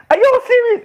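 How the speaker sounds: are you kidding me; incredulous. Real, not acted. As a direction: pitch it up in disbelief. Incredulous at the audience.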